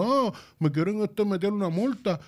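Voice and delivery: high, whining mockery of a fined partier; high sarcastic whine; mock whiny complainer. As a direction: mimic them whimpering about the fine. Mocking people who complain about fines.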